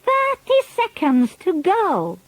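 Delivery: high, slow witch cry. A narrator's reading. Grand High Witch crying the countdown to the audience. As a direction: call out the countdown triumphantly. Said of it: "cried the Grand High Witch".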